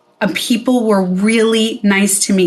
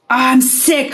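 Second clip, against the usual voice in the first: a hammed-up sick wail, higher; mock sick cry; 4 semitones higher than usual. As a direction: whine it out like a pretend patient. Faking illness to cancel clients.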